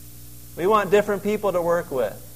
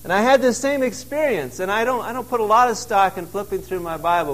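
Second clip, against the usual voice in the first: ordinary delivery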